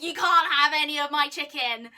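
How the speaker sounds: put-on guard your chicken like a greedy child; mock possessive outburst. Drunk, guarding McDonald's from friend.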